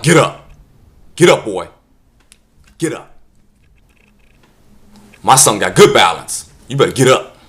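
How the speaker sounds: loud, tough dad barking orders; stern father. Acted. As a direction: order the boy up, loud and unsympathetic. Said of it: "your father like".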